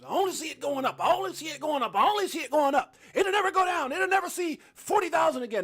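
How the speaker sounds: high, loud mock of deluded hypers; mocking blind bulls. Mocking overconfident crypto holders to his viewers, high and loud. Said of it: "walking around like a horse with blinders".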